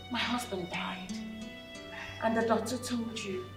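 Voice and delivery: mother dismissing her brother-in-law, cold and low; cold dismissive. Acted. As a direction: shrug off his grief coldly.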